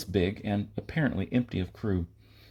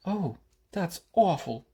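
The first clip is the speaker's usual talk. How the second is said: put-on high, slow, dismayed young woman; girl's voice; 9 semitones higher than usual. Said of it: "the girl said".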